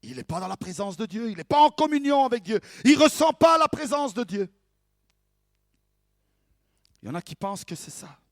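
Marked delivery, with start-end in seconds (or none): 1.3-4.5 high, loud, mounting denunciation; mock accusation. Preacher voicing believers condemning another, mock accusation. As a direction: denounce him loudly, pitch raised.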